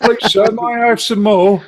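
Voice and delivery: high, loud mock pleading; pleading British orphan. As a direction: beg like a British urchin. Quotes begging orphan in British accent.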